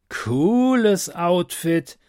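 langgezogenes, höheres Lob eines Punkers; anerkennend. Acted. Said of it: "sagte er anerkennend". (anerkennend, lässig gedehnt)

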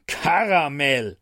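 slow, stern drawn-out name; scolding. Acted. Angel scolding his drunk friend, slow and stern. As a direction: tell him off with one long word. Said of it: "rührte er".

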